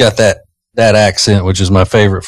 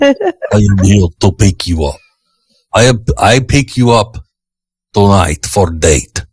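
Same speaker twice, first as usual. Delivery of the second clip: put-on thick Russian accent, trying to sound sexy. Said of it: "describing a thick Russian accent".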